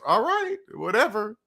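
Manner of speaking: high-pitched mock acceptance; dismissive. Host humouring another country's money rules, dismissive.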